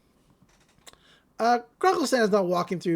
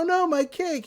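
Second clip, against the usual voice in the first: high-pitched mock wail over a loss; mock dismay; 8 semitones higher than usual. [name] has dropped his cake on the ground, mock dismay. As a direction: lament it in a higher voice, playfully.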